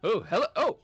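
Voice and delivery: high, loud flustered stammer; flustered dockhand. Acted. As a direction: fumble the greeting, caught off guard. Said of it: "I don't think that's what his accent was".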